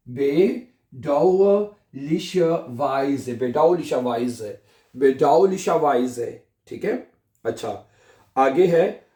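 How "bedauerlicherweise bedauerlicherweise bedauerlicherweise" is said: slow repetition. Shown as a demonstration. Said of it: "बनेगा bedauerlicherweise".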